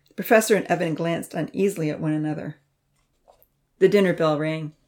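plain reading voice

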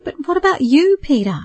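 lightly voiced begging mother, asking him to stay. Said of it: "she begged".

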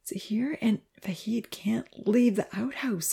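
slow, hushed whisper; worried boy whispering urgently; anxious. A narrator's reading. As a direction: whisper the bad news nervously. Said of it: "he relayed anxiously".

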